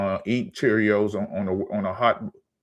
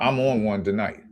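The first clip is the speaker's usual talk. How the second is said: ordinary talk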